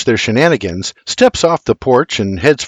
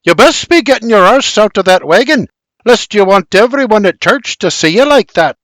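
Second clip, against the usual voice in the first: high, louder nagging; scolding; 9 semitones higher, 4 dB louder than usual. Acted. Berta orders him out of the wagon, scolding. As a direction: tell someone off from across the yard.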